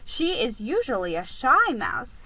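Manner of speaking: usual speaking voice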